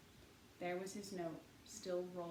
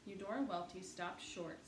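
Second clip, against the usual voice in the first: ordinary delivery, nothing marked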